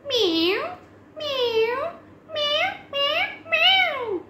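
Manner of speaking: high little meows, rising; squeaky kitten meowing. Pretend. Cat sounds in a kids' song.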